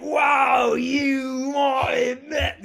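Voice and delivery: milk the line in an overblown Cockney shout; horrible Cockney accent. Retold or imitated. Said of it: "horrible Cockney accent".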